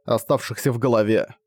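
plain reading voice

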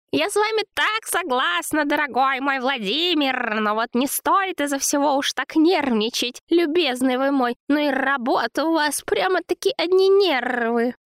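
very high, cooing flattery; babbling, fussing woman. A narrator's reading. Zina soothes angry Vova, high fawning babble. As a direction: coo and fuss to soothe him. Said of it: "Лепеча и пританцовывая".

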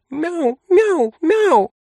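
very high kitten cries; insistent, high-pitched cat voice; heartfelt meowing. A narrator's reading. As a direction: meow it like an impassioned speech. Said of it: "разразилась длинной прочувствованной речью".

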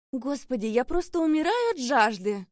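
high, loud and theatrically affected; affected, mannered voice. Acted. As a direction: gush it out like a finishing-school girl. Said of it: "с жеманными интонациями".

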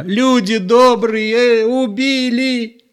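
high, slow frantic wail to the neighbours; drawn-out panicked wail. A narrator's reading. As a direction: wail for help, stretching the words. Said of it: "заполошно кричала Зоя".

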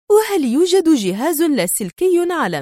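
high, curious character voice; interested. A narrator's reading. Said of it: "تسأله في اهتمام".

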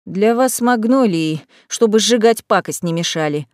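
ordinary delivery, nothing marked

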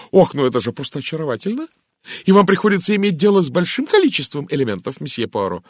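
high, loud, fast enthusiastic chatter; enchanted chatterbox lady; gushing Mrs Gardner. Acted. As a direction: gush breathlessly in delight.

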